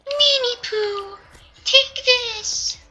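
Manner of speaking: very high, slow squeaky voice; high-pitched mock battle cry; squeaky cartoon voice.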